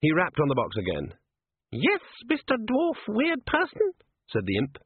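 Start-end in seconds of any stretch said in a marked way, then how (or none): 1.7-3.9 squeaky imp. A narrator's reading. Imp character voice.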